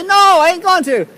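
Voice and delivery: loud high squawk of refusal; Mickey's mock refusal. Mickey refuses the vlogger in a loud high mock voice. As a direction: refuse him in a shrieking voice. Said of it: "He'll say".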